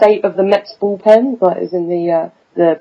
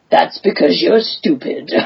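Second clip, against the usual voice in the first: Stick voice; 4 semitones lower than usual. Acted. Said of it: "a Matt and Stick one".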